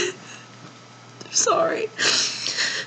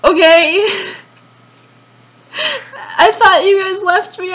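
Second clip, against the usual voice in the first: ordinary talk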